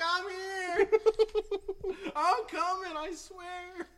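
high-pitched, loud retold yell; comic panicked holler, voice way up. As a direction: call out frantically across a huge hall. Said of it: "I'm yelling".

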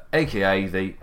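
normal talking voice

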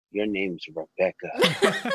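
hint of a low, slow divine voice; solemn name announcement.

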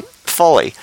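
Philly said as "Foley" in local accent. Shown as a demonstration. Podcaster mimicking locals naming their city, Philly accent. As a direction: give Philly a round "oh" vowel, Philly style. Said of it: "in Philly".

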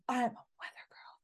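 hushed secretive whisper of the claim; a whispered boast. Retold or imitated. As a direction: breathe it like a secret. Romy's dream claim, retold.

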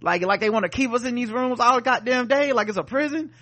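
intense. Retold or imitated. Recapper acting out Tick confronting the others, intense. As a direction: throw it back as a heated rhetorical question. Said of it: "he was just so intense when he was just like".

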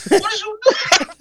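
high, mock-scolding old lady; hammed-up grandma tirade, high; grandma scolding the girl, high voice. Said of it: "She went in like".

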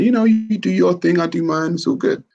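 ordinary talk

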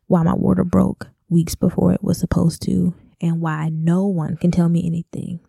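normal talking voice

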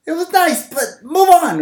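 high-pitched attempt at a screaming comedian; Bill Burr. Co-host trying the friend's impression, high mock exasperation. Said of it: "I can't do it".

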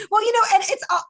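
high, loud laugh spilling into words; laughing burst. Real, not acted. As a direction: crack up at the story. Laughing at his story.

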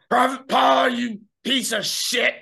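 high, far yelling drill sergeant. Acted. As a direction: bark it like a furious drill instructor.

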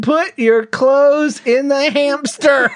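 loud, high, very slow mock order; re-enacted yell. Husband re-enacting his yell at their daughter, slow and loud. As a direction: space out each word like a fed-up parent. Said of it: "It was so loud".

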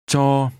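"Cho" held on a level mid tone. Shown as a demonstration. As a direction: say "Cho" evenly, with neither rise nor fall. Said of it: "Notice the mid-level tone".

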